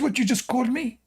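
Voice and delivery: high-pitched indignant questioning; angry woman. Retold or imitated. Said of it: "like, so angry".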